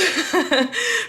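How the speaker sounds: short high embarrassed laugh. Real, not acted. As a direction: laugh it off sheepishly. Laughing at her own procrastination.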